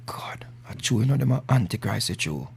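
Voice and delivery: playful low hushed creature voice; elephant voice. Pretend. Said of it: "You know, my elephant voice".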